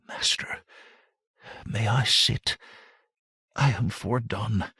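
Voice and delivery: exhausted whisper, breath barely there; scarcely audible whisper. A narrator's reading. Weary girl begging her master for rest, in a whisper. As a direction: barely voice it, worn out from the road. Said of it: "In a scarcely audible whisper".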